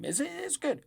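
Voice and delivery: ordinary talk, nothing marked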